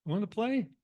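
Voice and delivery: a small kitten inviting a friend to play. Acted. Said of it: "The little kitten says".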